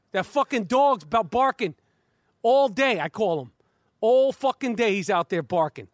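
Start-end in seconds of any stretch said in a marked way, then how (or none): none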